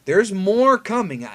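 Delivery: ordinary delivery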